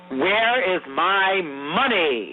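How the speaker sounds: drawn-out, menacing demand; slow mobster threat. Retold or imitated. Mob actor to a scared drug dealer, slow menace. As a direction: stretch every word like a tough guy leaning in. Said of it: "He's like".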